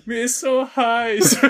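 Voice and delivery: high, slow, swooning complaint; overheated toaster. Pretend. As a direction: moan it out like a fainting diva. Voicing the toaster.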